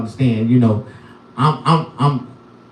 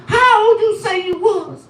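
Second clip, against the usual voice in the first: much higher, girlish put-on voice; high squeaky girlfriend impression; young girlfriend voice. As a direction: play the young side piece asking sweetly.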